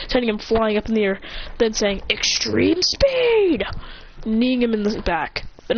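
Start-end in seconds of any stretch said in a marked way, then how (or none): 2.1-3.6 high, drawn-out anime move call; attack call. Acted. Hero rushing at Bakugo, high drawn-out attack call. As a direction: stretch out the signature move name.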